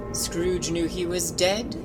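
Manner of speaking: ordinary delivery, nothing marked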